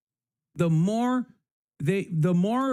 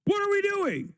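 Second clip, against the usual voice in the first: "What are we doing?" jumps way up high in disbelief, stress on "we"; incredulous; 12 semitones higher than usual. Real, not acted. Repeats question after long pause.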